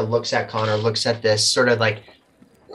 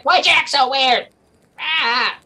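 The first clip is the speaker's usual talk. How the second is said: put-on much higher, squeaky nervous young man; Connor voice; 14 semitones higher, 4 dB louder than usual. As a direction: pitch way up for a flustered Connor. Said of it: "he's like".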